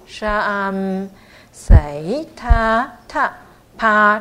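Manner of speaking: syllables drawn out in a slow chant; slow model recitation. Shown as a demonstration. Teacher reciting for learners to repeat, slow and drawn out. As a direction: recite very slowly, stretching each long vowel. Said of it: "再听两遍，然后大家再念一下".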